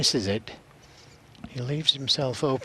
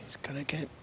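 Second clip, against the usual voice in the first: whispering; 3 semitones higher, 10 dB quieter, faster than usual. Real, not acted. Commentator hushed during shot.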